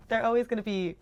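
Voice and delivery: normal talking voice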